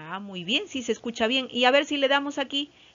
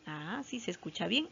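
ordinary talk, nothing marked

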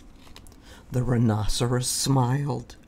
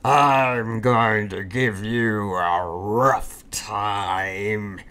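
menacing rhino; 5 semitones lower, much slower than usual. Acted. Rhinoceros threatens Fluffy.

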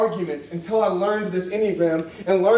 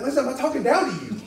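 higher, mock-preachy protest; acted talking-down voice; preachy denial; 8 semitones higher than usual. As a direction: deny it in a condescending preacher tone. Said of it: "You're talking down to me".

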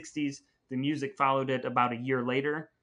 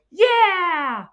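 put-on kids' cheer; 11 dB louder than usual. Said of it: "they go".

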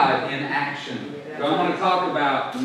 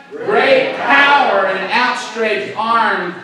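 loud, slow, raised declaration; emotional proclamation; 6 semitones higher, 7 dB louder, much slower than usual. Shown as a demonstration. Preacher continuing Jeremiah's words to church, emotional proclamation. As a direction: proclaim it slowly with full voice. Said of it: "you gotta put some emotion in this".

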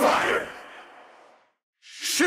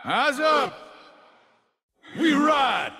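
shout. Acted. Game voice lines played.

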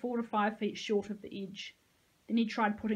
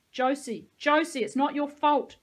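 loud, higher-pitched shout; 6 semitones higher, 9 dB louder than usual. A narrator's reading. Nico calls to Josie as she leaves, shouted. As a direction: call it out urgently at full voice. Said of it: "shouted Nico".

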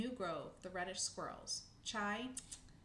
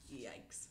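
low, soft yikes; low muttered yikes; 10 semitones lower, 5 dB quieter than usual. Acted. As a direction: wince it out quietly. Narrator reacts to fall.

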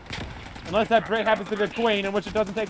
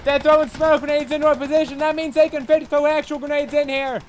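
loud, raised commands; shouting orders over battle; 6 semitones higher, 4 dB louder than usual. Real, not acted. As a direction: bark orders to the squad. Leading squad in firefight.

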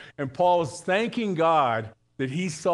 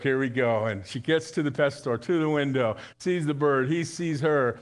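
ordinary talk

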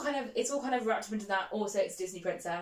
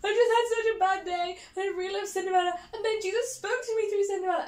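high, louder, tearful retelling of her own words; imitating sobbing; 11 semitones higher, 5 dB louder than usual. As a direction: replay the overwhelmed crying voice. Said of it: "like, sobbing, being like".

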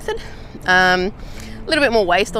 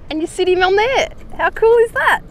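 delighted; 12 semitones higher than usual. Real, not acted. Delighted by the drip filter.

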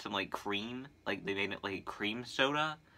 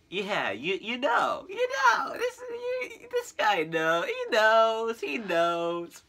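rising emotional shout; 11 semitones higher, 6 dB louder, slower than usual. Real, not acted. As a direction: voice climbing to a crying yell. Building emotional rant.

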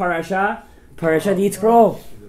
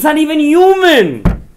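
higher, louder, slow outcry; emphatic exclamation; 9 semitones higher, 8 dB louder, slower than usual. Acted. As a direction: hammer it home in disbelief. Repeats louder, higher, slower.

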